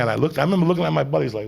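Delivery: ordinary talk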